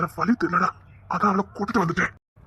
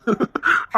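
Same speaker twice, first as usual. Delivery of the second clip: breathy laugh; 6 semitones higher than usual. Acted. Husband laughs.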